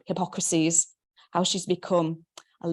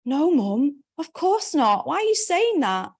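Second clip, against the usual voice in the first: indignant protest; 8 semitones higher, 4 dB louder than usual. Acted. Daughter protests to mother.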